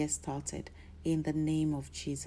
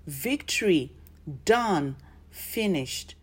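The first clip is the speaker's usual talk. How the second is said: loud triumphant cry, each word punched; shout of victory; 5 dB louder, slower than usual. Shown as a demonstration. Preacher voices Jesus crying out in triumph, loud shout. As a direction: proclaim it like a winner at the finish line. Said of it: "he shouted".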